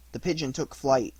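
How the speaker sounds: usual speaking voice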